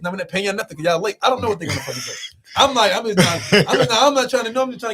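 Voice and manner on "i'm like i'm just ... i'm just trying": real laughter, louder as he talks; laughing. Real, not acted. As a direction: laugh through the words, exasperated. Laughing while telling the stress.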